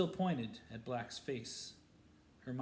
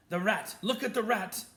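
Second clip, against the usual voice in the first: higher, louder frantic shout; desperate shout; 9 semitones higher, 9 dB louder than usual. A narrator's reading. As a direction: yell to make them look.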